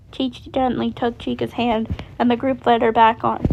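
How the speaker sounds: ordinary delivery, nothing marked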